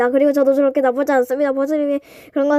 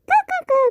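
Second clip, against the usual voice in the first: high wicked giggle; sneaky villain cackle; evil cackle; much slower than usual. Acted. Evil laugh before prank.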